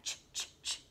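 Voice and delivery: whispered ch, ch, ch; unvoiced teacher ch sound demo. Shown as a demonstration. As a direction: breathe out a sharp voiceless ch three times. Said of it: "C H".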